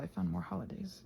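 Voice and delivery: normal talking voice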